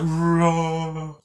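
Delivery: a hammed-up loud Nemesis roar; loud monster roar. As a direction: bellow it like a hulking monster. Retelling Nemesis roaring.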